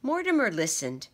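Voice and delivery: usual speaking voice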